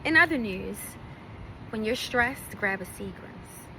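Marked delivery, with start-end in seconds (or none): 1.7-3.7 lower, softer commercial voice; mock ad read. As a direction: sell it like a late-night ad, low and smooth. Mock ad after In other news.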